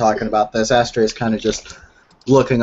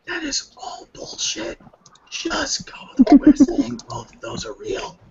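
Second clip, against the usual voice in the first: hushed unvoiced aside; whispering; slower than usual. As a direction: whisper it behind his back. Astra secretly leans to Tallulah.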